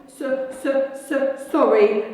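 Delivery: stammering, tearful apology; sobbing giant. Acted. Hagrid sobbing his apology to McGonagall. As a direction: choke out the sorry through tears. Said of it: "sobbed Hagrid".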